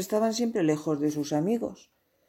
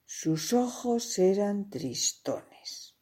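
plain reading voice

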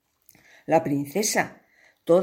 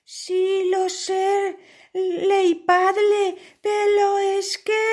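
put-on much higher, girlish pleading; pleading princess; 13 semitones higher, much slower than usual. Princess begs her father, the king, high and pleading. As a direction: beg your father sweetly.

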